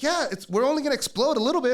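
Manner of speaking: high, loud exasperated retort; sarcastic alarm. Retold or imitated. Alarmed friend answering a careless helper, loud sarcasm. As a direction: throw it back sarcastically, pitch way up.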